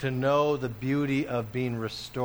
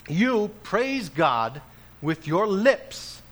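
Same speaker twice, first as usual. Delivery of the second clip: slow, higher, deliberate quote; quoting James; 6 semitones higher, 5 dB louder, much slower than usual. Acted. Preacher quotes James to the church, slow. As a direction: spell out each word. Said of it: "James says it this way".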